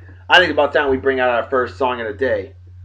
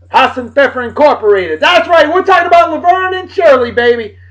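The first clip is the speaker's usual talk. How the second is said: excited high announcer; 10 semitones higher, 5 dB louder than usual. Real, not acted. Laverne and Shirley theme.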